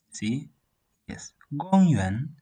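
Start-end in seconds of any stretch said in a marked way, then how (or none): 1.6-2.4 "Gong yuan" loud, high "Gong", rising "yuan"; high, then rising. Shown as a demonstration. Teacher repeating for learners, "Gong yuan" high then rising. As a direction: start "Gong" up high, drop and lift "yuan". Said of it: "Then it rises up to this point".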